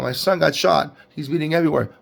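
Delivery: usual speaking voice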